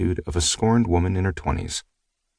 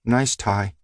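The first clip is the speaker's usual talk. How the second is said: begrudging mutter; 4 semitones higher than usual. A narrator's reading. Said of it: "begrudgingly muttering".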